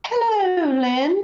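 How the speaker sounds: high, drawn-out eerie greeting; imitated spirit lady. Investigator imitating a lady spirit greeting her, high and drawn out. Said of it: "and this lady goes".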